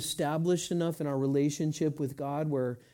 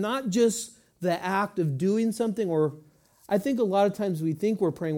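normal talking voice

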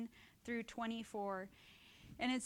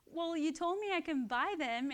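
higher, louder retold whine; mock protest; 7 semitones higher, 6 dB louder than usual. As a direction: play up her own indignant reply. Her retold reply to brother.

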